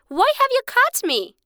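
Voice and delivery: high, small voice of a captured bird; a tiny bird asking its captor, startled; little bird voice. Acted. Caught bird questions the rich man, high and startled.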